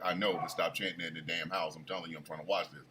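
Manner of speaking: very low, soft imitation of a wife; quiet, irritated order to her husband; wife's muted scolding. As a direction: mutter it, fed up but hushed.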